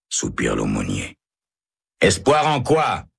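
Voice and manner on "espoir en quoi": cry out. A narrator's reading. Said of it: "crie Herbert".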